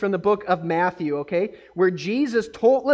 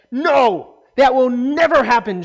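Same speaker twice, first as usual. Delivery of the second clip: louder, firm refusal; protesting; 2 semitones higher, 6 dB louder than usual. Retold or imitated. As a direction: push back hard as Peter. Peter protests Jesus' prediction.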